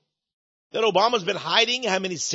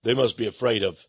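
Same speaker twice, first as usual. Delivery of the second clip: lower, scoffing take on Obama; lightly voiced Obama, brushing off the critics; mock Obama; 11 semitones lower than usual. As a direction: Obama waving it away with a mocking laugh, lower voice. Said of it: "Obama laughed".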